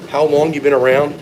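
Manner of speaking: ordinary talk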